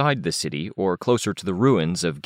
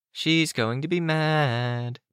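slow, high teasing chant; sing-song; 10 semitones higher, slower than usual. A narrator's reading. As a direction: draw it out into a taunting sing-song. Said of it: "sing-song".

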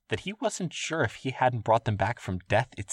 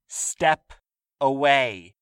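stern order; 5 semitones higher, 5 dB louder, much slower than usual. Acted. Guard repeating order.

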